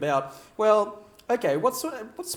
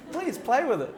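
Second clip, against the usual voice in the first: ordinary talk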